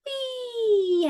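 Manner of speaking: very high, loud whoop of delight; gleeful yell. A narrator's reading. Froggy whoops while riding his bike, very high and loud. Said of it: "He yelled".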